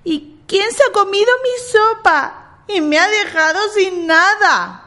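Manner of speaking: put-on high, tiny baby bear voice, slowing at the end; little bear's sweet complaint. A narrator's reading. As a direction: squeak it like a small sad cub. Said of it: "dijo con vocecilla muy dulce".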